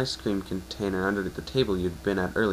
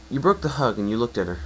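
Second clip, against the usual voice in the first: ordinary delivery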